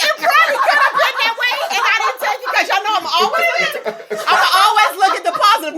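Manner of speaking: high, quick laughing admission; laughing while talking. Real, not acted. Conceding to her co-hosts, laughing high. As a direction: talk through the giggles.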